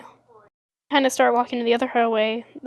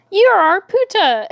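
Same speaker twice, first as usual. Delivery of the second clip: retold loud, drawn-out announcement, pitched up; loud blurt; 8 semitones higher, 5 dB louder, slower than usual. Patrick greeting Scott with a new word, loud blurt. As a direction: blurt it out slowly and loudly, like a clueless kid. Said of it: "Patrick just says it so loud".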